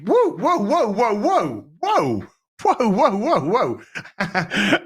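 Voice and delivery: high, laughing whoa whoa whoa; reining himself in while laughing; giddy stream of whoas; excited whoas. Real, not acted.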